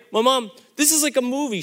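usual speaking voice

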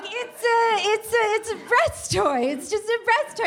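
high, stammering, hurried excuse; flustered explaining. Retold or imitated. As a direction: stumble through a panicky explanation. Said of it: "I'm trying to explain what that is".

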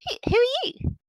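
put-on high, curious little girl voice; a small child popping up, nosy and bright; orc child voice. GM voicing a curious orc child to the players. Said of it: "you hear a voice behind you going".

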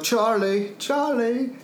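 mock anguished calling after a friend; lightly acted worried cry.

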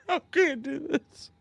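high, slow, choked with laughter; losing it to laughter; laughing. Real, not acted. Host overcome by laughter.

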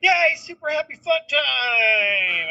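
high, slow hammed-up cheer; mock fun dad. Dad coming home to his kids, hammed-up cheer. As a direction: burst in as the goofy fun parent. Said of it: "I come home and I'm like".

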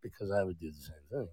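unvoiced confiding whisper; whisper aside. Real, not acted. Dad confessing to friend in a whisper. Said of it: "whispered aside to friend".

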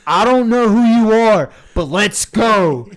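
high, booming imitation of a fan's battle cry; hyped-up shout. Friend imitating Matthew psyching up before the urn, hyped shout. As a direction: shout it drawn out, pumping yourself up.

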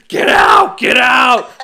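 high, loud yell of get out; shouted get out. Retold or imitated. Retelling the movie scene, a high loud shout. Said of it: "he's like".

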